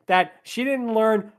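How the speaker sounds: usual speaking voice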